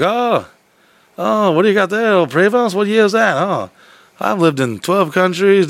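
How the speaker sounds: high, hammed-up Canadian accent. Truck driver looking over the broken bus, imitated Canadian accent. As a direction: mimic a curious, chatty Canadian, pitch lifted. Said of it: "with this Canadian sounding accent".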